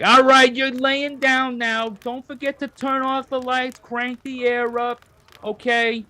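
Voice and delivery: high, loud, bossy New Yorker. Acted. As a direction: order them to bed like a pushy New York mom. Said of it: "the annoying, loud New Yorker".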